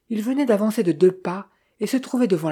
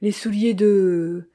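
slow, raised cry, left unfinished; mild drawn-out outcry; 3 semitones higher, 4 dB louder, slower than usual. A narrator's reading. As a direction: call it out, stretching the last word. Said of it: "cria-t-elle".

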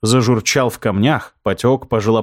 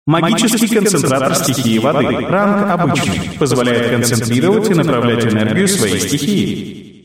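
game system voice. Acted. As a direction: read it like a game interface. Game item description read out.

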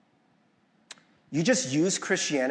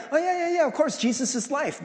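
much higher, breezy offhand "oh yeah yeah yeah"; casual agreement; 10 semitones higher than usual. Retold or imitated. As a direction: toss it off like it's obvious. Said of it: "It sounds like".

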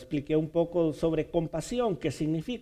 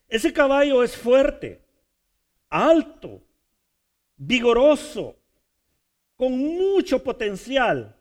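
building praise; 7 semitones higher, 5 dB louder than usual. Acted. Preacher building intensity.

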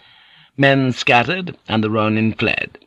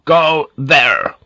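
raised, firm order; higher-pitched commanding call; powerful command; 5 semitones higher, 4 dB louder than usual. A narrator's reading. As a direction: send them off with authority. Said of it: "the power of his voice".

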